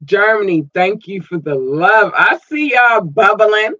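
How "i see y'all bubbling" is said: upbeat, a bit slow and bright; warm-up before the big repeat; lively. Real, not acted. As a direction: say it cheerfully first. Repeats line much higher.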